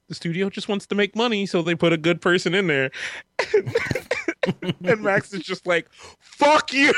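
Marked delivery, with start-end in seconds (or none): 0.1-2.9 higher, quicker voice for a retold argument; Scott. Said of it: "And then Scott's just like". 6.2-6.9 mock angry shout, high and loud; Max. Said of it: "is making me mad".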